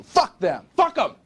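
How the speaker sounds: high, spitting outburst; scornful outburst. Acted. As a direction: toss them away with contempt. Boss rant.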